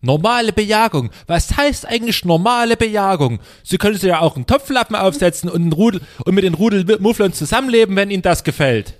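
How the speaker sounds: a resident working himself into a rage; fed-up complaining that climbs into a shout; escalating comedy rant. Acted. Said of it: "ich spreche quasi den Anwohner".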